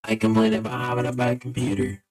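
robotic vocoder with stepped, locked notes. Real, not acted. Player stuck as a vocoder, wanting the voice back, vocoder voice. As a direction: voice run through a vocoder effect. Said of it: "deal with my voice like this for a second".

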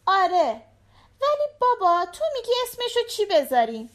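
put-on high, quick little-girl voice; a young girl eagerly explaining to her dad, pitched high; little daughter. A narrator's reading. Narrator voices the daughter asking. (صدای زیر و تند دختربچه)

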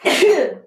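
one real sneeze burst; sneezing. Real, not acted. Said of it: "Hapşırdım".

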